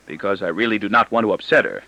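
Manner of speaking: ordinary delivery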